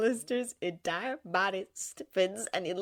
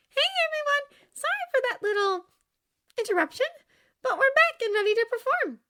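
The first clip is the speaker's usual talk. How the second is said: high, loud, chirpy stage host; Charlie addressing audience; 7 dB louder than usual. Acted. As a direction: welcome the crowd back, pitched high.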